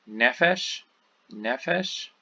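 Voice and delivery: nefesh with stress on NEF, second syllable light; penultimate stress. Shown as a demonstration. Teacher demonstrating segolate stress on nefesh, carefully. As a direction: say nefesh twice, first syllable strong. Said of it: "here's the accent".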